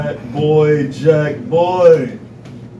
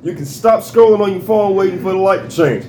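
ordinary delivery

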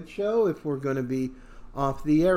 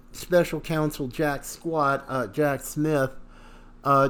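ordinary talk, nothing marked

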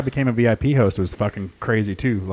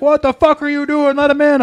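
much higher, louder furious yell; angry boss yell; 7 dB louder than usual. Retold or imitated. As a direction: bark it down the phone at him. Boss yelling over phone.

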